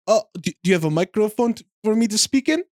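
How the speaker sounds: halting mock Kazakhstani accent, rising high; puzzled question in a put-on Kazakhstani accent; Borat's accent. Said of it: "Borat starts speaking".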